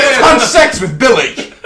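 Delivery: mock boast. Mock boast about Billy.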